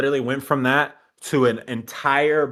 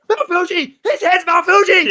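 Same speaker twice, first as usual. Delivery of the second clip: very high, loud mock yelp; hammed-up shocked squeal; startled shout; 9 dB louder than usual. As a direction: shriek it in disbelief. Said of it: "he said".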